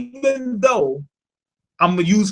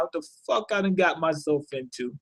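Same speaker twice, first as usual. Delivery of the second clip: much softer, half to himself; inner thought; 9 dB quieter than usual. Retold or imitated. Bolin's thoughts replayed for viewers, soft inner voice. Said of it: "that's basically what's on his mind".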